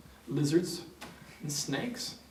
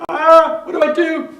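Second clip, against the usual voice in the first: high, loud, helpless mock panic; acted frantic yell; panicked screaming; 17 dB louder than usual. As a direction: cry out as if freaking out.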